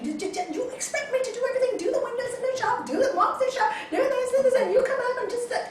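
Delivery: very high, fast, laughing shrill tirade; mock screaming wife rant. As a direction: shriek it at the husband.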